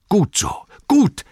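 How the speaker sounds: very high, loud cheer; exclaiming approval. A narrator's reading. Farmer cheering the plan to start, high loud cry. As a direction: cry out your eager approval, high and rising in force. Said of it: "rief der Bauer Katun".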